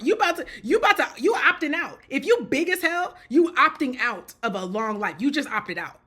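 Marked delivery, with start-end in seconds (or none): 0.0-4.3 fast, loud, heated rant; heated shout. Real, not acted. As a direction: shout it out in disbelief. Ranting at obese person.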